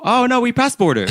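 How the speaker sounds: very high, breezy driver voice. Retold or imitated. Driver shrugging off the border, high and breezy. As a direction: toss it off high and cheerful.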